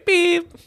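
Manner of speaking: high-pitched mock machine beep. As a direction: squeak out a beep tone. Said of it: "after the beep".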